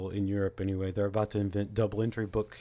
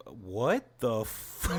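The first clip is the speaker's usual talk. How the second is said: slow, drawn-out disbelief; a slow, baffled what-the-fuck; stunned disbelief; much slower than usual. Retold or imitated. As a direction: let it sink in slowly, dumbfounded. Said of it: "And you're just like".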